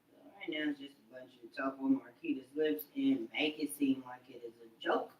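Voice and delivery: low hushed whisper. Real, not acted. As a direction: whisper it under your breath. Whispered aside.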